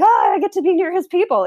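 high, gushing fan voice; starstruck fan. Retold or imitated. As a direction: squeal it like an eager fangirl. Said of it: "I was like".

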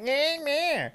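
high, thin nerdy squeak. Retold or imitated. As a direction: squeak it out like a timid nerd. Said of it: "I gave him a little nerdy voice".

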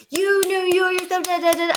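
high-pitched ranting imitation; mock enraged yelling. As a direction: mimic a teen ranting, trailing into nonsense syllables. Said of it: "doing the clapping thing to me".

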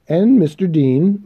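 eager society lady's exclamation; exclaiming. A narrator's reading. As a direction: call it out over the churchgoers. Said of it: "Missus Montgomery exclaimed".

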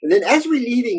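ordinary talk, nothing marked

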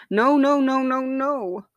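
high, loud, desperate no; cling on and refuse loudly; frantic refusal. A narrator's reading. Heart refuses to cross, loud desperate cries. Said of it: "heart slammed on the railing".

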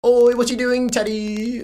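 high, bubbly British impression; Lucas Wilson. Repeating the friend impression on request, high bubbly British. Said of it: "do it again".

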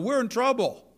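a hint of a lost driver's dismay; mock panic. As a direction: play up the panic of being lost. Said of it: "I thought".

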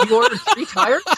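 high, disbelieving squeak; retold disbelief at three tires; incredulous. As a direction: ask it in shocked disbelief. Incredulous retold quote to neighbor.

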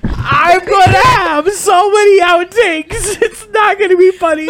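high-pitched laughing through tears; laugh-crying, barely getting words out; hysterical laughing. Real, not acted. Hysterical over lost take.

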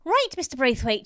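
brisk nurse taking charge, loud; matter-of-fact. A narrator's reading. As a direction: step in firmly and practically. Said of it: "in her most matter-of-fact voice".

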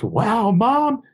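put-on high, laughing kid voice of an excited daughter; excited little girl. A girl gushing to her mom, high and laughing. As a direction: squeal it out like a thrilled girl. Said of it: "she's just".

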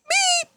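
very high mechanical beep; imitated beep. Mimicking the noise as work lights come on, high beep. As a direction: squeal out a machine beep.

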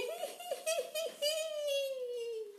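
high wicked cackle; gleeful villain cackle; evil cackle. Acted. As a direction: cackle long and high like a witch. Villain gloating over stolen eggs.

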